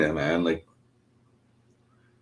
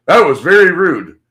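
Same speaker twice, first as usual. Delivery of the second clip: loud, higher-pitched mock scolding; scolding stranger; 6 semitones higher, 10 dB louder than usual. Quoting the man who corrected his language, loudly scolding. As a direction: imitate a stranger telling someone off, loudly. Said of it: "trying to tell me how I'm supposed to talk".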